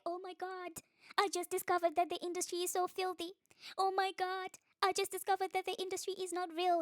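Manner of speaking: high-pitched mocking imitation; put-on shocked gossip voice; mock astonishment. Mocking people's so-called discoveries.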